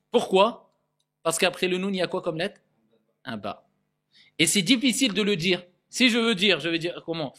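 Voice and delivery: normal talking voice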